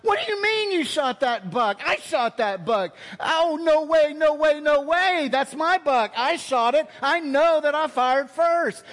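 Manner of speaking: high, loud squabbling claim; indignant hunter. Retold or imitated. Troy bickering with David over the buck, high and indignant. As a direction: argue the buck is yours, pitched high and loud. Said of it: "Troy is like".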